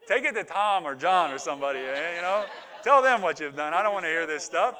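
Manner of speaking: loud, comic brush-off; hammed-up loud protest; mock exasperated refusal. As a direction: send them packing at full volume. Joking refusal to hear confessions.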